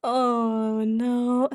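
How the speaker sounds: hammed-up oh no; mock dismay. Real, not acted. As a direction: dread the request playfully. Mock dismay at chat request.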